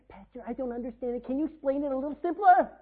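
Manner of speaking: mock a whining believer. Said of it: "like a baby".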